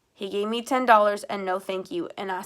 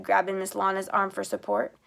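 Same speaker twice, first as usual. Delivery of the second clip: usual speaking voice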